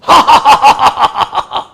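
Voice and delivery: a big, loud burst of laughter; hearty laugh. Real, not acted. Laughs at own recital.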